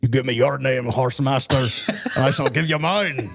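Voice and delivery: gruff-role dwarf line in a put-on accent; put-on fantasy dwarf voice; Gimli. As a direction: play the proud dwarf warrior, measured ending. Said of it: "so Gimli".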